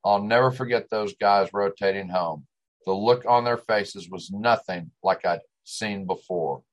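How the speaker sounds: ordinary delivery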